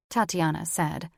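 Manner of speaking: usual speaking voice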